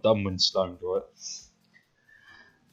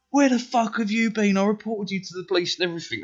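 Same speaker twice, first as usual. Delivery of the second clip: angry mum; 11 semitones higher than usual. Retold or imitated. Mum yelling at her son who came home late, high furious shout. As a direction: shriek it like a panicked mother. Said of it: "And my mum's like".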